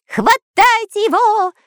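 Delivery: very high, drawn-out squealed order; shouting. A narrator's reading. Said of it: "из-под кровати кричал шпион Дырка". (визгливый крик)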